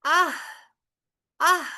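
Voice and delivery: آہ with breath cut then flowing out; ha demo. Shown as a demonstration. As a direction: stop the voice, let air flow. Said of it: "اگلا لفظ". (حا کی آواز، سانس کے ساتھ)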